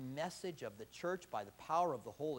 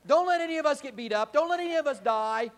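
higher and louder, a fast anxious plea slowing on the last words; self-serving plea; 7 semitones higher, 10 dB louder than usual. Pretend. Preacher mocking his own fearful prayer, loud pleading. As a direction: beg God to keep you safe, hammed up. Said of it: "That would have been my prayer".

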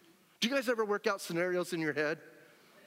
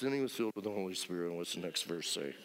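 lower, quick, unexcited read; bored flat reading; 11 semitones lower, 4 dB quieter, faster than usual. Shown as a demonstration. Preacher mocks how the congregation reads it, bored and fast. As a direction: rattle it off like a dull Bible reading. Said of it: "we kinda read it like this".

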